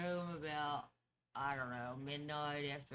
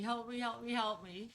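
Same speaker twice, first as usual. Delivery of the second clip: mock cry for help, higher pitch; half-hearted pleading yell, higher; retold panicked yell; 7 semitones higher than usual. Stuck arm, yelling to Courtney for help, mock panic. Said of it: "I start yelling at Courtney".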